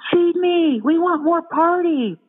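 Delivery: high, slow little-creature demand; demanding critters. Retold or imitated. Critters demanding food from the brain, high and slow.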